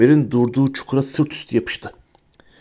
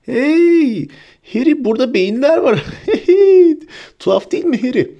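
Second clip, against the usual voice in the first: very high giddy squeal with laughter; tipsy high-pitched delight; giggling, drunken Ron; 12 semitones higher, 3 dB louder than usual. A narrator's reading. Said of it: "kıkırdayarak, sarhoş gibi yalpalayarak".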